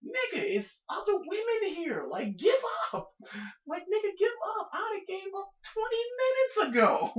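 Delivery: high, exasperated, half-laughing outcry; exasperated high yelling. Real, not acted. Exasperated rant about thirsty man.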